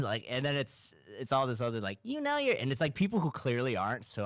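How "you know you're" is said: squeaky high put-on Boston voice; mocking TikTok Boston videos, a forced Boston accent. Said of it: "a forced accent".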